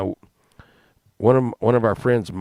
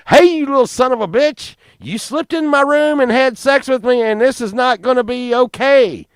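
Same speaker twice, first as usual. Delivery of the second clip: mock scolding; 10 semitones higher, 4 dB louder than usual. Mom imagining yelling at Steve.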